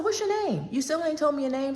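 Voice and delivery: you still won't tell me your name, come on; exasperated demand. Retold or imitated. Pressing caller for his name.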